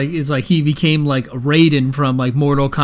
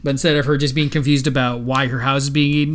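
normal talking voice

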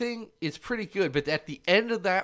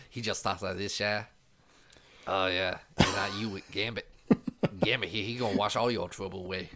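mock Cajun accent, low and soft; Gambit; 8 semitones lower, 3 dB quieter, faster than usual. Mocking Gambit's world's worst accent, low fake Cajun. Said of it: "He has the world's worst accent".

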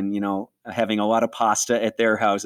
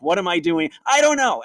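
panicked inner cry; 9 semitones higher, 5 dB louder than usual. Retold or imitated. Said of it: "And I was like".

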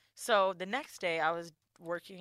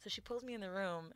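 normal talking voice

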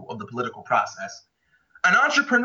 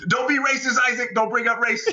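mock scolding; 6 semitones higher than usual. Mocking critics telling him off.

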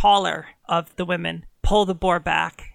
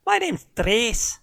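slow, higher Irish lilt; Irish huntress; 5 semitones higher, slower than usual. Acted. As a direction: introduce herself with a proud Irish brogue. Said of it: "slipping into Irish now".

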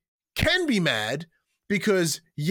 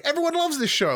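"Everyone loves this show." high and gushing, stress on "loves"; naive enthusiasm; 10 semitones higher than usual. Retold or imitated. Mocking his own wrestling-bubble view, high gushing enthusiasm. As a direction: gush it like a starry-eyed fan. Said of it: "like, I'm like".

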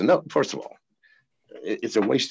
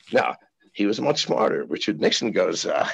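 ordinary delivery

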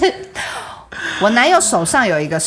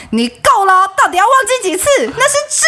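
mock-furious, pitched way up; a hammed-up exasperated snap, climbing higher; fed-up outburst; 11 semitones higher than usual. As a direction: act out the friend finally snapping. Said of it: "他直接发火说".

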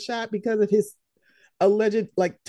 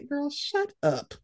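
dismissive; 4 semitones higher, 5 dB quieter, slower than usual. Real, not acted. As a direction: drag it out, unimpressed. Dismissing the hacked excuse.

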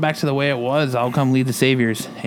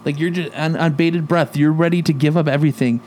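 mocking Negan; 5 semitones higher than usual. Negan taunts Mark about his burned face, lightly imitated, incredulous. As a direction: sneer it up at him like Negan, can't believe him. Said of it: "He's like".

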